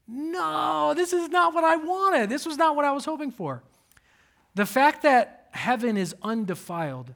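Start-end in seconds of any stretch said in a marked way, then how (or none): 0.1-3.6 high, whiny letdown voice; high-pitched mock dismay at the damaged truck; disappointed whine. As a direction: whine it like a let-down kid.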